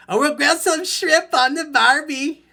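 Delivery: high, loud mock Australian accent. Coach mocking Australians with a loud Aussie accent. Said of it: "Probably be a few Australians in there".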